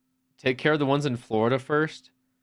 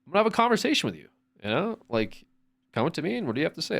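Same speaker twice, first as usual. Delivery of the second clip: fast, higher reasonable talk; brisk friendly grown-up tone; adult conversation; 5 semitones higher, faster than usual. Retold or imitated. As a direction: speak to the kid as an equal. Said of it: "it's like".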